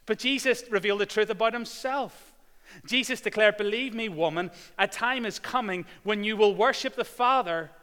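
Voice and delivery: loud, high full-force preaching. Acted. Preacher retelling Jesus to the congregation, loud and high. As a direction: proclaim it to the whole church.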